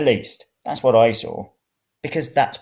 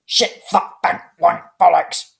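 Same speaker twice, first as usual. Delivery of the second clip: swearing out loud, pitched up; shouting; 7 semitones higher, 5 dB louder, slower than usual. Acted. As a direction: spit out the curses in a yell after a fall. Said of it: "Jay shouted".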